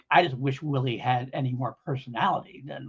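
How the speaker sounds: ordinary delivery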